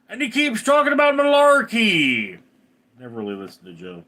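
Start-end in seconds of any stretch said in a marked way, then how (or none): 0.1-2.4 put-on raspy old man raising his voice; loud, much higher strained old-man rasp; Joe Biden. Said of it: "He's an old man with a raspy voice".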